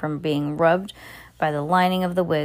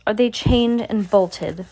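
slow, loud, higher harsh witch voice; witch demanding; 5 semitones higher, 4 dB louder, slower than usual. Acted. Grand High Witch questioning the room, harsh and loud. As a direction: drag it out, menacing and suspicious. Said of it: "shouted the Grand High Witch".

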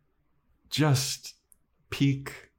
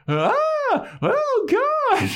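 very high shrieking panic; panicked scream; 4 dB louder, much slower than usual. Retold or imitated. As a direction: shriek in terror. Panicked bystanders voiced.

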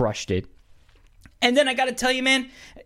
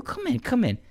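much higher, soothing Pacino plea; Pacino calming down; 11 semitones higher than usual. Retold or imitated. Pacino's character smoothing things over with De Niro, coaxing. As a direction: coax him back in a high placating voice. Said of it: "get De Niro's character back into the room".

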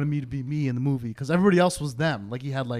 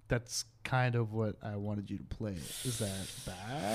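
imitated hesitant reply, slow and very soft; sheepish admission; 5 semitones lower, 15 dB quieter, slower than usual. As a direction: drop low and tread carefully. Retold sheepish admission by director.

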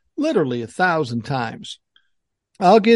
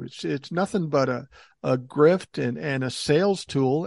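ordinary talk, nothing marked

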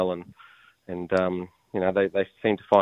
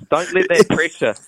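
raised, hammed-up coach encouragement, slowing on keep going; mock pep talk; 7 semitones higher, 4 dB louder than usual. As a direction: play a mock motivational coach, half joking. Teasing pep talk to co-host.

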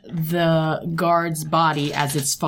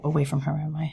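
whisper; 5 dB quieter, faster than usual. Real, not acted. Player whispers mid-combat.

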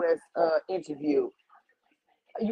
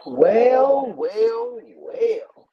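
dramatic well well well; 7 semitones higher, 7 dB louder, much slower than usual. Real, not acted. Dramatic opener.

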